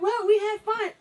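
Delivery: high, loud imitation of a friend; friend's protest. Imitates friend's excuse for posting.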